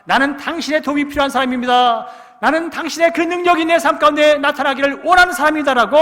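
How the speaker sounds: very loud, high desperate shout. Retold or imitated. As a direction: give it everything you have. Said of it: "더 크게".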